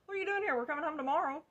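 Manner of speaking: high, loud mock-surprised friend. B running up to the car, high loud surprised greeting. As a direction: squeal it like a shocked girlfriend. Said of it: "and she's like".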